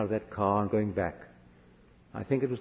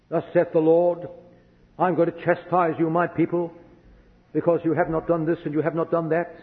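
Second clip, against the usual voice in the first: loud, raised-pitch mock prophet's pronouncement; judgmental prophecy; 5 semitones higher, 3 dB louder than usual. Church prophet chastising God's people, judgmental. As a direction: proclaim judgment as a stern prophet. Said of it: "he was always judgmental".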